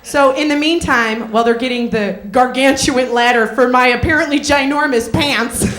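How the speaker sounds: ordinary talk, nothing marked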